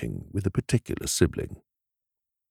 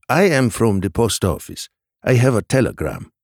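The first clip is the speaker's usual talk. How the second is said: higher-pitched messenger's voice; post office messenger; 4 semitones higher, 4 dB louder than usual. A narrator's reading. Post office man greeting a shop owner, formal messenger voice. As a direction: announce your errand as a post office man.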